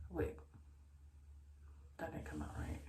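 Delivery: whispered aside. Real, not acted.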